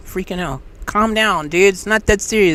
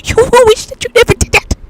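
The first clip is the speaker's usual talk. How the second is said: high, ominous warning from the inner voice; faster than usual. Retold or imitated. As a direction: spook them off it, up high. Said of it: "the tingling sensation telling you".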